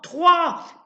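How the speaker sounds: high, very loud final shout; old woman yelling the final count before firing; screamed count. Acted. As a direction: scream the last number.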